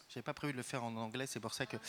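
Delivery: fast, soft French aside to himself; rushed muttered aside. Real, not acted. President apologising to audience for switching languages, rushed and soft. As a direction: hurry the words under your breath.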